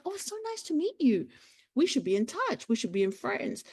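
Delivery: high, lightly voiced sweet greeting; a hint of a gracious celebrity; friendly reply. Celebrity answers a nervous fan, lightly voiced and sweet. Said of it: "and she's like".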